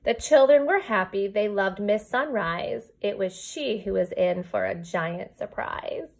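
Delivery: lilting storybook read-aloud; reading to children. Acted. Teacher reading a rhyming book to her kindergarten class, storybook lilt. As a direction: read the rhyme to your class, gently bouncing.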